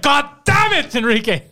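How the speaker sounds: high burst of mock anger, dropping on the name; explode, then sag in exasperation; exasperated. Gang leader scolds the muffin bringer, exasperated.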